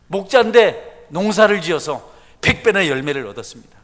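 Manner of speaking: high, loud, fervent sermon; full-force preaching. Acted. Pastor exhorting the congregation, loud fervent preaching. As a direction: preach it out to the rafters.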